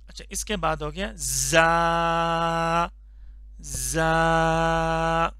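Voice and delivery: زا drawn out, slow and firm; firm zaa. Shown as a demonstration. Teacher demonstrating زا with firmness, drawn out. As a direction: press زا hard and slowly. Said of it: "تھوڑا سا سختی کے ساتھ پڑھیں گے".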